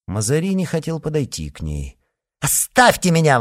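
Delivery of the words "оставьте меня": loud, high dismissal; sharp raised rebuff; angry exclamation. A narrator's reading. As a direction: exclaim and push away. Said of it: "воскликнула она".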